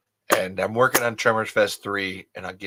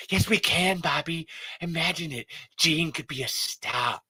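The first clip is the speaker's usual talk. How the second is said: higher, excited cartoon wife; Linda Belcher; 5 semitones higher than usual. Wife insisting to husband son could star, excited impression. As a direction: cut in high and eager, acting. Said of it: "It's Linda".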